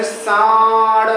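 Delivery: सांड with a drawn-out nasal AA; सांड slowly, the vowel held in the nose; nasalised vowel. Shown as a demonstration. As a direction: stretch सांड through nose and mouth together. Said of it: "हवा मुंह से भी निकल रही है और नाक से भी".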